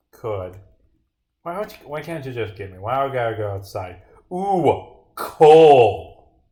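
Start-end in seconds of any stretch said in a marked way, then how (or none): 4.3-6.4 mock wonder. Mock awe at finding coal.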